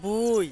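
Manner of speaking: after a pause, say বুই as the local word for land; বুই in broad Sirajganj dialect. Shown as a demonstration. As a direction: give বুই the Sirajganj way, short and clear. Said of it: "জমিরে বলি".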